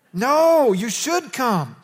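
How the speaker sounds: loud, high, drawn-out objection; emphatic correction. Real, not acted. Preacher overruling an imagined excuse to skip church, emphatic. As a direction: call it out loud and slow to overrule them. Rebuts imagined don't-come reaction.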